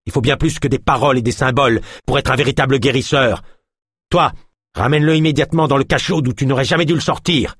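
high, scornful, speeding up; contemptuous healer. A narrator's reading. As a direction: dismiss him coldly then order. Healer orders the guard.

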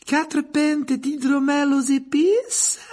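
high, slow, bright-voiced barmaid. A narrator's reading. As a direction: lift the voice high and serve the drinks sweetly. Narrator voices barmaid Rosmerta.

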